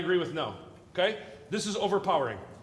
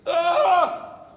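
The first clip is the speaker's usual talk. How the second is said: high, loud cry of "ah"; loud high exclamation; sudden loud "ah"; 10 semitones higher, 9 dB louder than usual. Real, not acted. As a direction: burst out with a big high "ah". Teacher reacting to student counting answers.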